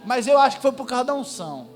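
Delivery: ordinary delivery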